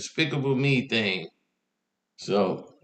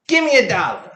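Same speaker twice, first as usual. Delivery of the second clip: put-on higher, louder child's demand; demanding kid; 8 semitones higher, 5 dB louder than usual. Five-year-old insists on money from mom's boyfriend, demanding. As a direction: insist like an impatient little kid.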